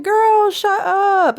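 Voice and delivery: high, loud, drawn-out party squeal; delighted disbelief. Acted. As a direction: stretch it out high, like a thrilled friend. Imagined friend reacts in delighted disbelief.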